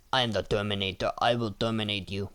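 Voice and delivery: a quick half-serious Terminator voice; Terminator impression. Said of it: "But I'm not gonna talk like that".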